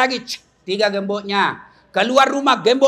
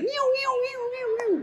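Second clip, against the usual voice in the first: high, soft wailing siren noise; imitated siren; 8 dB quieter than usual. Shown as a demonstration. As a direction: mimic a police escort siren, high-pitched. Menirukan sirene pengawalan PJR. (tiruan bunyi sirene)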